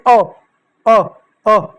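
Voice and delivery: अ drawn out as a short open vowel; vowel sound demo. Shown as a demonstration. Teacher sounding अ for the children. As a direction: say अ slowly, one sound at a time. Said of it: "ऐसा अ की ध्वनि होती है".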